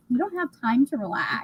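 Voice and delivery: soft, ominous hushed warning; quiet sinister. Retold or imitated. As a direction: murmur it like a quiet threat. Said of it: "like, quietly spoken".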